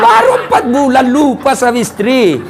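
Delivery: laughs through the punchline; laughing. Real, not acted. Preacher laughing at the widower who remarried fast. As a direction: chuckle as you mock him.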